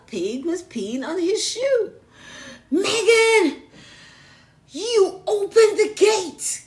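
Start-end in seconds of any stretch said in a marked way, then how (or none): 2.7-6.6 loud, high, slow angry shout; yell. Acted. Father yelling at Megan about the pigs, loud and slow. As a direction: bellow at the child, dragging the words. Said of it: "yelled her father".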